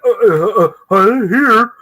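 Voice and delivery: high, loud, stammering fluster; starstruck shock. Retold or imitated. Fan handing a flyer to his idol, stammering in shock. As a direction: act out being lost for words, voice jumping up. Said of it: "you were in shock at the time".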